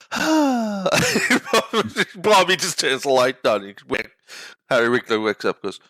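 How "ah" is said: high, strained dismayed "Ah". Retold or imitated. Coach watching his team fumble, high stunned ah. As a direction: let out a squeaky stunned ah. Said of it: "he's just there going".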